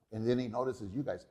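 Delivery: ordinary talk, nothing marked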